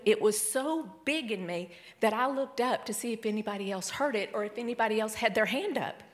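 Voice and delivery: normal talking voice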